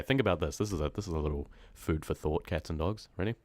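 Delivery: ordinary delivery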